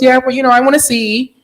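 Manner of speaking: high, pleading mom voice with rising end; imitating mom. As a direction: whine it a little like a mother who wants to see.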